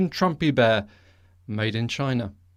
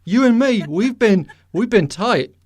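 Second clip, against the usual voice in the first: pleading bro voice, pitched up; mock plea to God; 7 semitones higher, 5 dB louder than usual. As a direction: whine it like a hurt buddy. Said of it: "I'd be like".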